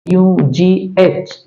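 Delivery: slow letter-by-letter spelling; यू जी एच said letter by letter, slowly; spelled out slowly. Shown as a demonstration. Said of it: "उसी तरह से".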